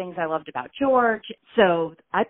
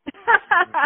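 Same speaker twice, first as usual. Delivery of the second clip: laughing; 12 semitones higher, 6 dB louder than usual. Real, not acted. Laughs at reaction.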